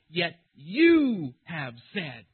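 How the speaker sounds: "you" very loud and long drawn out; slow down and blast "you"; deliberately emphatic YOU. Acted. Preacher quotes Moses to God, deliberately emphatic "you". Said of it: "he's deliberately emphatic in that".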